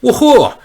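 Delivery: high, loud whoop of joy; real woohoo; excited woohoo. Real, not acted. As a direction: let out a happy whoop. Excited over move.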